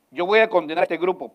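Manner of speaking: loud, quick divine threat; voice of God condemning. Pretend. God condemns the hypocrites, loud and quick. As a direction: proclaim judgment like God. God's voice quoted loudly.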